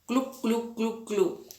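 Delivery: gluc gluc bajito de tragos; tragando agua, más suave. Shown as a demonstration. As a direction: imita beber a tragos suave. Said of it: "¿cómo hacemos nosotros?". (sonido de tragar suave)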